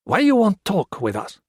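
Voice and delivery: a wary bandit testing the stranger; suspicious guerrilla. Acted. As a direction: guerrilla challenging the king. Chinese guerrilla voiced suspicious.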